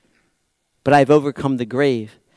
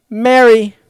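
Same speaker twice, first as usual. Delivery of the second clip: firm call; 7 semitones higher, 8 dB louder than usual. Shown as a demonstration. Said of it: "That's it".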